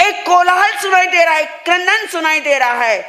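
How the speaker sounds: high-pitched urgent report; acted alarm. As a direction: raise the alarm in a storyteller's voice. Voices disguised god raising alarm.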